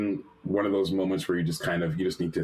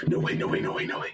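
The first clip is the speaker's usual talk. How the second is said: whispered, breathy disbelief; unvoiced whisper of racing disbelief; breathless disbelief. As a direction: whisper it in a stunned rush. Reads email, can't believe it.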